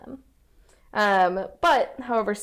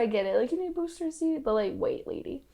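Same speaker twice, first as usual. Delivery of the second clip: sarcastic; 7 semitones higher than usual. Retold or imitated. Sarcastic retold usher quote.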